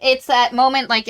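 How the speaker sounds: ordinary talk, nothing marked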